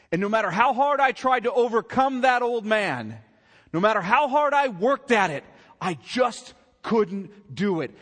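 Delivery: loud, high voicing of the singer's plea; mock confession. Preacher voicing the singer's confession, loud and high. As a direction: play his despair back at the crowd, shouting. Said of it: "That's what he's saying".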